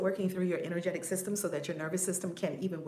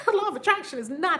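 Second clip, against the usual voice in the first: laughing, higher and louder; 9 semitones higher, 6 dB louder, faster than usual. Real, not acted. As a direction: laugh through the line. Laughing emphasis.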